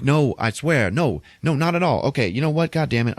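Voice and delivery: high, rattled mock denial; retold scrambling to deny it; flustered protest. Acted flustered denial on phone.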